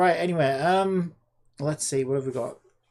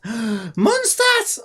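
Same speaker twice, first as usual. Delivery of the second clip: gasp, then a slow, high, loud reveal; excited gasp; 7 semitones higher, 9 dB louder, much slower than usual. Real, not acted. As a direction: discover it with a thrilled gasp. Realizes, gasps excitedly.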